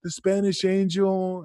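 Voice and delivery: student telling his trainer he dislikes the name, careful and slow; polite complaint. Retold or imitated. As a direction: tiptoe around the complaint. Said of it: "and I'm like".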